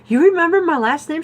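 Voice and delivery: high, loud, fast amazed question; amazed disbelief. Retold or imitated. Woman amazed an old friend remembers her surname, excited. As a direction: fire it off in happy disbelief.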